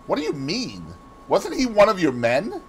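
ordinary delivery

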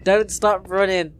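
normal talking voice

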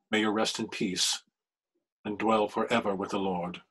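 measured liturgical recitation; solemn prayer. Acted. Elliston prays over Phoebe's body in solemn recitation. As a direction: intone the last rites with steady reverence.

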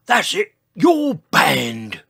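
loud, slow, high outburst; George. A narrator's reading. Dinosaur laying down the law to the dancer, loud slow outburst. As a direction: stretch it out as a loud decree. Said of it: "said George".

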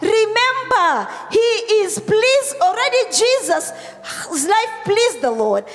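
full-force preaching. Real, not acted. Pastor to congregation on Jesus praying all night, forceful preaching. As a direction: preach it out, stretching the key words.

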